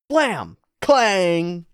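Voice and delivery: punchy vocal sound effects; imitated gunshot and clang. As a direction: voice a shot and a metallic clang. Imitating gunfire in manga action.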